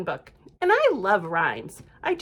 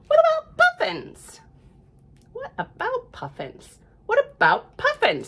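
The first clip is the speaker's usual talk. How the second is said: squeaky, much higher frog voice; eager high frog; 13 semitones higher, 4 dB louder than usual. Acted. Frog asks the cat in a squeaky voice. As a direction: pipe up like an excited little frog.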